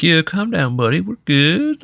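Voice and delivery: high, breezy laid-back dragon; chill dragon voice. Retold or imitated. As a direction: talk the dragon down casually. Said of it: "terrifying dragon voice, but like".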